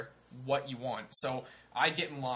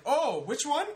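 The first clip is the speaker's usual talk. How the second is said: very high, loud put-on old man; 13 semitones higher, 7 dB louder, slower than usual. Old cashier asking the customer, high caricatured questioning voice. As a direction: play a puzzled old shopkeeper, pitched way up.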